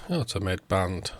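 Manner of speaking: ordinary talk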